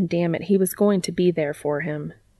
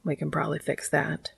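murmur; 8 dB quieter than usual. A narrator's reading. Dean answers Cass beside him in bed, murmuring. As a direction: murmur it close and quietly. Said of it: "Dean murmured".

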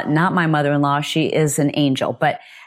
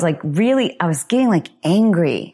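ordinary delivery

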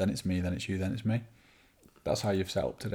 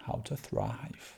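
slow, drawn-out title; 4 semitones higher, 10 dB quieter, much slower than usual. Acted.

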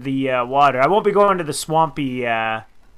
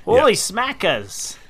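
slow, drawn-out mock Australian exclamation; a lightly voiced Aussie catchphrase; Australian accent; 6 semitones higher, much slower than usual. Co-host repeats the angler's Australian catchphrase, slow and light. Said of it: "I shouldn't do the Australian".

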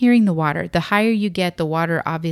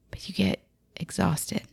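breathy, worn-out whisper; exhausted whisper; 8 dB quieter than usual. Acted. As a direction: let it sag out, drained. Climbing, describing exhaustion.

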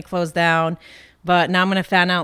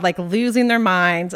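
normal talking voice

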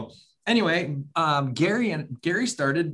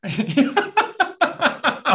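laughing burst; 12 semitones higher, 4 dB louder than usual. Real, not acted. Laughs at the joke.

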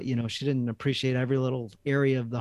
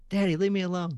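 put-on whiny child; 8 semitones higher than usual. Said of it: "I just grab him".

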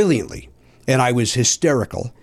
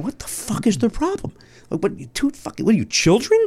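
incredulous inner voice; 9 semitones higher than usual. Retold or imitated. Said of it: "I'm in my head going".

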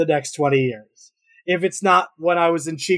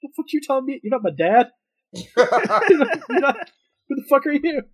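put-on indignant teen; 10 semitones higher than usual. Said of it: "I'm like".

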